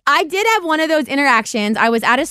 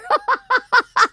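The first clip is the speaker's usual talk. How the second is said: high, delighted laugh; 12 semitones higher than usual. Real, not acted. Tall fan recognizes her, high laugh. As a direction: burst into a high giggle.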